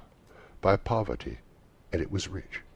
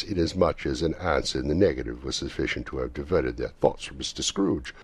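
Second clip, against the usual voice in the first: ordinary delivery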